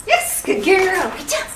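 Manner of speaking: high, loud burst of praise for a dog; gushing, high-energy reward voice; really excited praise. Real, not acted. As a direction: mark it with an excited yes. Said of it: "that really excited yes sound".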